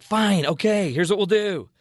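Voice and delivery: high, put-upon "fine"; exasperated concession. Retold or imitated. Acted quarrel, giving in.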